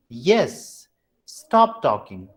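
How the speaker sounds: slow command, falling on TALKING. Shown as a demonstration. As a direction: sink low at the end of each phrase. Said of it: "Mostly this comes to command".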